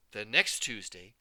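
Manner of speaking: usual speaking voice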